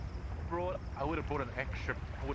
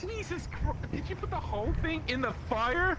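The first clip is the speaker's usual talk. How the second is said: shocked disbelief; 11 semitones higher, slower than usual. Real, not acted. Shocked at burger in fire.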